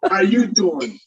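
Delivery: put-on New York tough-guy accent; East Coast accent. Showing off accents to get laughs, New York accent. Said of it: "I've worked on different accents".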